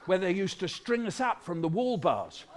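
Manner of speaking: ordinary delivery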